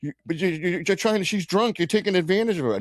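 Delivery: high, fast accusing rant; heated accusing quote. Retold or imitated. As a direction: reenact the guy calling him out.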